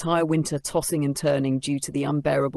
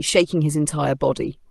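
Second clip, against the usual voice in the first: usual speaking voice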